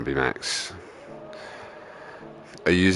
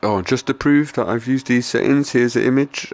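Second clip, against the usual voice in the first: higher, put-on presenter voice; sarcastic mimicry, pitched up; mocking YouTuber imitation; 6 semitones higher, 4 dB louder than usual. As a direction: parody a YouTuber showing off his proof. Mimics YouTuber showing off proof.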